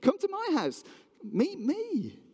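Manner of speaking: high, whiny crowd complaint; mock grumbling and moaning. Jealous crowd grumbling to Jesus about the tax collector, mock moaning. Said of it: "They grumbled, they moaned".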